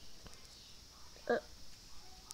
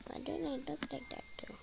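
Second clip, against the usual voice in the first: whispering; 16 dB quieter, faster than usual. Real, not acted. Quiet aside while watching.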